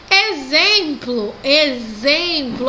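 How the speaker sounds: exemplo traag, x als z: e-zem-plo; drawn-out exemplo with z; slow model word. Shown as a demonstration. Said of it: "o som de Z".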